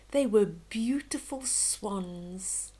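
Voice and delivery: ordinary delivery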